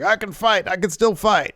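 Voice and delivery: high-pitched retold insistence; mock pleading. Jensen insisting to trainers, mock pleading. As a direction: plead as the hurt wrestler.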